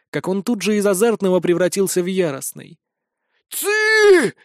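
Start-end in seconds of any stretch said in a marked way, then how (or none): 3.5-4.3 high, drawn-out angry ты; drawn-out furious cry. Acted. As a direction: stretch it out in rage. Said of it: "протянул он".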